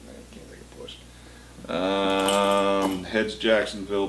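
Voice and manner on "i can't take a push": whispering. Real, not acted. Echoes partner's line in whisper.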